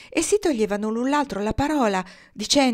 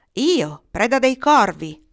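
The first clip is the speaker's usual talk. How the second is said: louder, higher proud announcement; defiant retort; 4 semitones higher, 7 dB louder, slower than usual. A narrator's reading. Boy answers the mocked blackbird, defiant retort. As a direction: call it out boldly.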